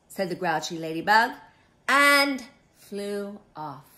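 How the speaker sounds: ordinary delivery, nothing marked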